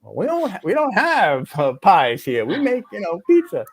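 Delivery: high, mocking waitress imitation; hammed-up high voice of the pizza waitress. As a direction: pitch up to play the confused waitress. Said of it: "waitress voiced in story".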